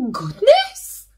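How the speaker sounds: put-on high, loud, startled old lady voice; little old lady. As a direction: gasp it like a surprised granny. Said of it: "the little old lady said".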